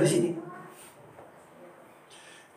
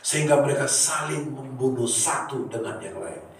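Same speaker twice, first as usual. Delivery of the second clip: normal talking voice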